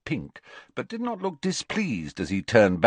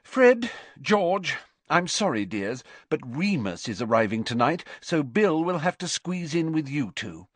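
higher, fussing mother's voice; fussing mum; motherly voice; 8 semitones higher than usual. Acted. Mrs Weasley speaking.

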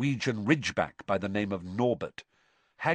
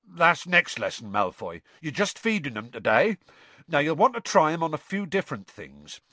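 higher, louder, gruff gamekeeper; rough Hagrid; 7 semitones higher, 5 dB louder than usual. Acted. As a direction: bluff giant brushing off the question. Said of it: "Then he said roughly".

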